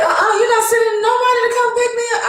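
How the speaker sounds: high, loud, rapid protest; retold yelling. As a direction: yell the refusal, pitch jumping way up. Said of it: "I started yelling".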